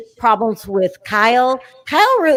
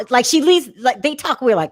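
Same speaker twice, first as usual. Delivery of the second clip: ordinary talk, nothing marked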